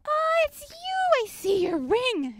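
high, slow mock child voice; a child catching the teacher out; imitating a kid. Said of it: "the kids will be like".

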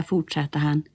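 usual speaking voice

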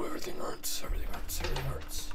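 drained whisper; real spent whisper. Real, not acted. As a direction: breathe the words out, exhausted. After scare, drained.

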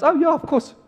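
easy agreement. Retold or imitated. Congregation's easy agreement voiced.

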